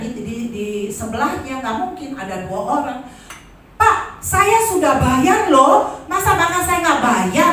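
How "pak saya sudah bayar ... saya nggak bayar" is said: much higher, louder, slow outraged objection; retold indignant complaint to the restaurant man; indignant protest. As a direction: insist you already paid, offended. Protesting unpaid bill accusation.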